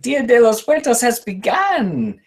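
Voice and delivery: put-on high, slow old witch. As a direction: croak it like the witch from Brave. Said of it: "sounds a little bit like the witch from Brave".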